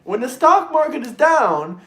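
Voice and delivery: normal talking voice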